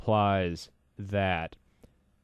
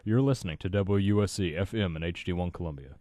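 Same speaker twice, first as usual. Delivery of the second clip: smooth station-ID announcer delivery; radio DJ voice; 2 semitones lower than usual. Pretend. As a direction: put on a DJ voice for the station ID. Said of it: "get into my D J voice".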